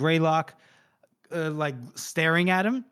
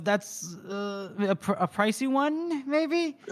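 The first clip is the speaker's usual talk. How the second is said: halting, climbing high into a tentative question; awkward hesitation; 6 semitones higher, slower than usual. Retold or imitated. Flustered man rescuing a botched compliment to Monza, hesitant. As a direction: imitate a man digging himself out, unsure.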